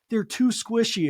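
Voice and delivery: high, slow whiny complaint; whiny teen. Retold or imitated. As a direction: whine it like a picky kid. Imitating Jade's complaint.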